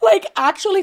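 high squealing laugh through the words. Real, not acted. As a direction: crack up mid-sentence, pitch jumping up. Mocking Candace, bursting into laughter.

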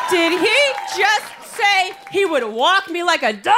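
high, slow mock outrage; scandalized disbelief. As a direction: stretch it out in hammed-up shock. Acted outrage at the lyric.

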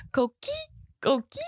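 high little frog chirp, coqui; coqui frog call. Retold or imitated. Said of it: "Flynn always goes".

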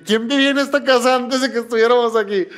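high, loud, urgent alarmed asking; alarmed question. Retold or imitated. To his roommate about the ghost girls, alarmed and loud. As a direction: blurt it out, spooked and pressing.

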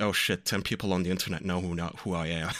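low, subdued retold dismay; dismayed realization. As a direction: let the worry sink in, voice dropping. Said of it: "but now it's like".

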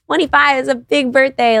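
mock birthday excitement. How the birthday should have felt.

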